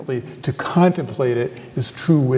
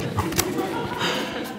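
quiet laugh; 10 dB quieter than usual. Real, not acted. Laughs at own confession.

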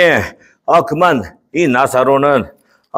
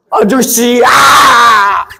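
loud call from afar, higher; 11 semitones higher, 5 dB louder, much slower than usual. Acted. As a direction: shout after him from a distance. 멀리서 부름. (멀리서 아저씨를 크게 부르는 소리)

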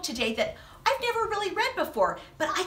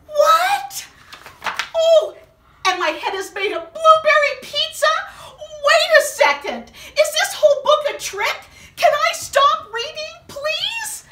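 high, loud mock distress; comic panic; 11 semitones higher, 7 dB louder than usual. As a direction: whine and plead, hammed up.

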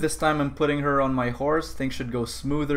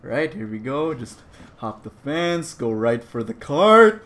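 usual speaking voice

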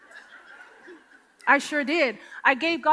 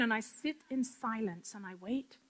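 ordinary talk

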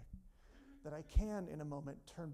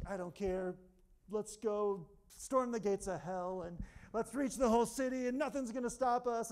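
building to a loud, higher rallying cry; fired up; 5 semitones higher, 8 dB louder than usual. Retold or imitated. Pastor rallying to storm the gates of hell, rising loud. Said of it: "I get a little fired up".

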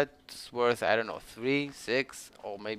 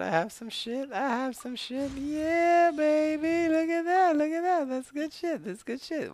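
much higher, gleeful voice; excited squeal. Real, not acted. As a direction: squeal over the loot. Excited high voice at loot.

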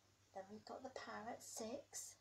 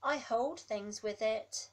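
big booming elephant, much louder; 15 dB louder, slower than usual. Acted. Elephant claiming its trunk, booming loud. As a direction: boom it out like a proud elephant. Elephant's line in picture book.

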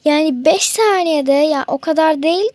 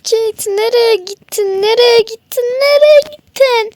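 rising louder cries from calling to near-shout; searching friend calling louder and louder; calling out, building; 6 semitones higher, 7 dB louder than usual. Acted. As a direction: call for Kate more desperately each time. Calling for lost Kate.

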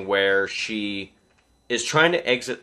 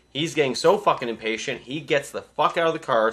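usual speaking voice

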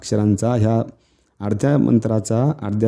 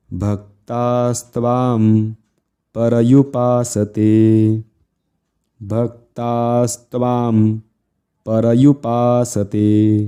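slow, deliberate Sanskrit chant; careful slow recitation; much slower than usual. Shown as a demonstration. Teacher drills students on the half verse, slow and careful. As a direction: articulate each long syllable with care. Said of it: "नीट काळजीपूर्वक उच्चार करायचा आहे".

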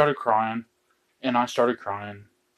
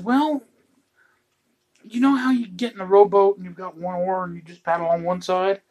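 high-pitched, coaxing explanation; mock-innocent husband explaining himself; tentative explaining; 7 semitones higher than usual. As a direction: ease into it in a raised voice. Acting himself explaining to crying wife.

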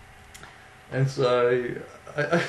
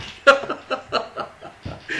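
high-pitched burst of real laughter; helpless laughter; 13 semitones higher, 4 dB louder than usual. Real, not acted. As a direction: crack up at your own story. Cracking up at own retold story.

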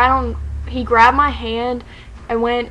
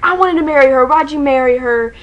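retold yelling; 2 semitones higher, 4 dB louder than usual. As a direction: imitate an indignant complaint that eases off. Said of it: "he starts yelling at Zayn".

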